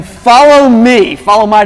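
husband grandly demanding his wife follow him; proud command. Retold or imitated. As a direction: declare it like a leader, slow. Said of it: "I mean".

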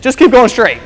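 husband overruling wife in the car, confident. Retold or imitated. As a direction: brush her off like a man who knows best. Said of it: "I said, as a man".

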